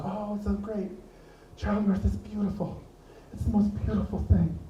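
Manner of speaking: lightly put-on dreamy gushing uncle; a hint of a sappy uncle gushing; mocking uncle voice. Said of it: "This is my uncle talking like this".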